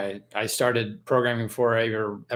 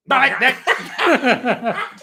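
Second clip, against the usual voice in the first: loud high laughing fit; 14 semitones higher, 8 dB louder than usual. Real, not acted. Laughing at the accent video.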